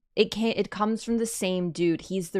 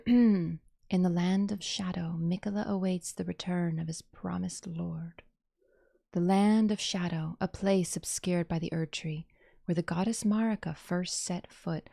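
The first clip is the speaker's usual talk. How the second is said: trailer narration. Acted. Gamer reading the video description aloud, solemn narration. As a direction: read it like an epic trailer.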